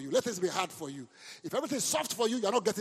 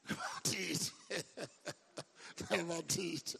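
words broken by laughter; real laughter over a joke; laughing; 5 dB quieter, slower than usual. Real, not acted. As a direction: chuckle through the words. Laughing at his own joke.

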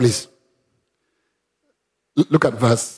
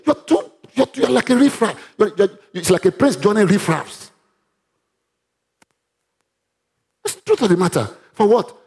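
high, heated preaching; real fervent outburst; screaming; 5 semitones higher than usual. Real, not acted. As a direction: pitch it up in rising indignation. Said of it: "I'm screaming, I'm screaming".

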